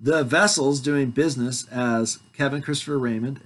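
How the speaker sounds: usual speaking voice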